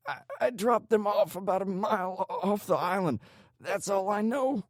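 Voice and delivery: high, stammering, desperate voice; pained panic. A narrator's reading. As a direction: stammer out the last confession, desperate.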